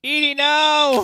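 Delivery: long high desperate cry; shout. Real, not acted. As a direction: yell her name in panic. Companion falls in game.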